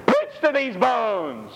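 high, slow solemn command; voice of God. Acted. The Lord commands the prophet, high and slow. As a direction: pronounce it from on high.

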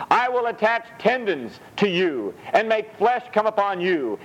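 high, slow proclaiming read; scripture reading. Acted. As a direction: let each promise ring out slowly. Reads scripture aloud.

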